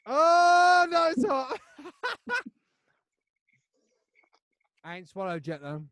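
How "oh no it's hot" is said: high strained cry from chili heat; yelping at the burn; burning mouth. Real, not acted. Eating very hot chips.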